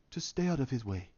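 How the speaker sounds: low, hushed, understated advice; quiet warning. Acted. T'Challa warns the Wakandans, quiet and low. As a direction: drop to a soft, grave murmur.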